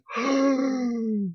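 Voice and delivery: a sharp, high gasp of shock. Real, not acted. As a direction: suck in air, stunned. Shocked at the arrest.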